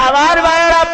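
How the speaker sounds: crying appeal. Acted. Preacher calling his brothers in the crowd, choking up. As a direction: call out to them through tears.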